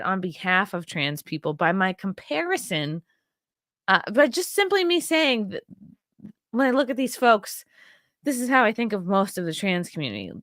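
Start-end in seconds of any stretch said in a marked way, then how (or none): none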